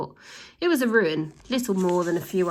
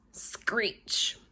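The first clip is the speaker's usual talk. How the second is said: a soft unvoiced screech noise; brake screech; 2 semitones higher, 5 dB quieter than usual. A narrator's reading. The narrator imitates the van screeching to a halt. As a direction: hiss out the squeal of brakes.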